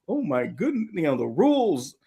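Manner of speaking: high, fussy pearl-clutching whine; mock country club senators, whining. Senators stunned at broken Senate rules, whining. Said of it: "and they whine".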